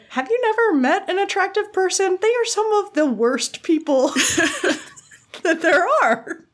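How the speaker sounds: high, amused disbelief breaking into a laugh; incredulous retort. Retold or imitated. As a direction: laugh through the comeback. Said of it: "I was like".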